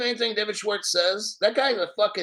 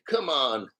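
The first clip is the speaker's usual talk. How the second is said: ordinary delivery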